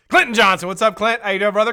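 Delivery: high and loud greeting; loud, friendly livestream welcome; excited shout-out. Real, not acted. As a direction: call his name out with gusto. Calling out greeting to viewer.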